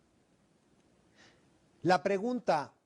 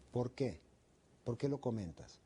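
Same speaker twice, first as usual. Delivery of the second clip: soft, low "por qué", not aggressive; low calm why; 4 semitones lower, 6 dB quieter than usual. Shown as a demonstration. Coach asking calmly why someone generalises about women. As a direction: ask why gently, without raising the tone. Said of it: "el tono es muy, muy importante".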